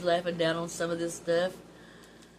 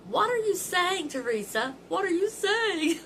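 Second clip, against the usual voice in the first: put-on much higher, louder, slow demanding old mother; suspicious mother; 12 semitones higher, 7 dB louder than usual. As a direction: call out loud and slow like a suspicious mom. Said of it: "Mama was going".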